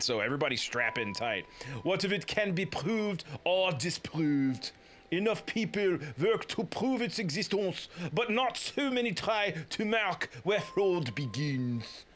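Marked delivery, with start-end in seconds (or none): 1.8-12.0 slow, higher put-on French accent. Toussaint explaining his study of fraud, thick French accent. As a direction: labour through a bad French accent. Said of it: "an accent parade".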